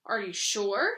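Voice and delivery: ordinary delivery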